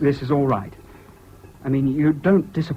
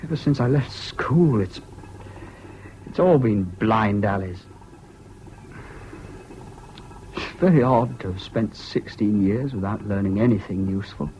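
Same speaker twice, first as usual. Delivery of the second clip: despondent; 5 semitones lower, 4 dB quieter than usual. Acted. Laments his wasted schooling.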